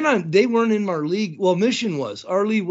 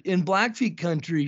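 usual speaking voice

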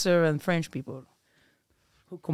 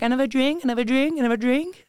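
higher, repeated rising offers; lightly imitated pushy waiter; 6 semitones higher, 3 dB louder than usual. Imitating American waiters pestering a diner, mock-eager voice.

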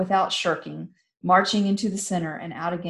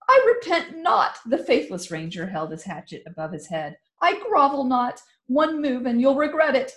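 much higher, louder defiance; defiant shout; 6 semitones higher, 3 dB louder than usual. Acted. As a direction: spit back the refusal. Traitor defies commander.

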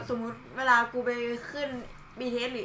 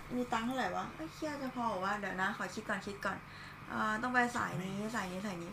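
muttering to himself; 8 dB quieter than usual. Retold or imitated. Man muttering his fare worries before boarding. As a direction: count it over quietly under your breath. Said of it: "ส่วนมากที่จะพูดก็จะแบบ".

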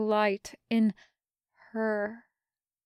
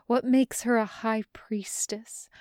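plain reading voice

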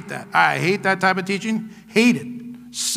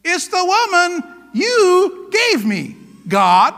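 high, drawn-out finger-pointing; accusing; 8 semitones higher, much slower than usual. Acted. Preacher as Adam blaming God for the woman, accusing. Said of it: "he's pointing his finger at God".

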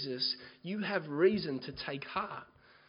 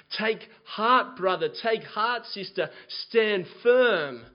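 louder, higher rallying sermon cry; fiery preaching; 4 semitones higher, 8 dB louder than usual. Acted. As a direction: rouse the congregation not to give up. Preaching at full force.

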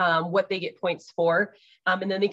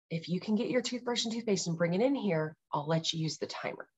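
soft coaxing; 6 dB quieter than usual. Retold or imitated. Mom coaxing child to brush.

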